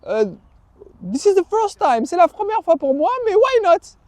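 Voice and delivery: hesitant surprise. Retold or imitated. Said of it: "Je dis".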